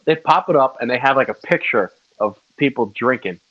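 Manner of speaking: ordinary talk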